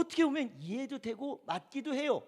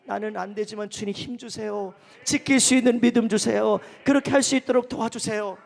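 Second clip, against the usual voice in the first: earnest plea building to a loud cry; pleading prayer; 9 dB louder than usual. Acted. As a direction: pray aloud, swelling into full-voiced petition. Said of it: "기도하면서".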